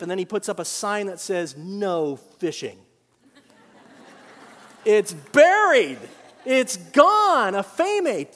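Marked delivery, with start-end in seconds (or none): 1.6-2.8 No fishing drawn out slowly, deadpan; slow punchline. Acted. Pastor delivers the sign's joke, slow deadpan. 4.8-7.5 loud, slow, higher proclamation; preaching shout. Acted. Pastor proclaims sin is gone, loud and slow. 7.5-8.3 Apheme called out loud and high. Acted. Pastor cries out the word to the church, loud. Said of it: "word repeated for emphasis".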